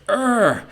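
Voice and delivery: short high "ugh" of exasperation; exasperated groan. Retold or imitated. Owner groaning on hearing the job looks unfinished, frustrated.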